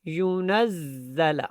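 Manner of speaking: slow ينزل with a firm doubled z; deliberate recitation, heavy shadda on the z of ينزل; hard za. Shown as a demonstration. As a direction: press and hold the z in ينزل. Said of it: "زا سختی کے ساتھ ادا ہوئی ہے".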